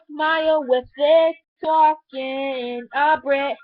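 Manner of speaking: normal talking voice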